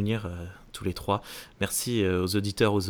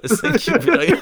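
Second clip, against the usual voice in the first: high, loud thanks through laughter; laughing thanks; 5 dB louder than usual. Real, not acted. Co-host signs off in English for the anglophone listeners, laughing.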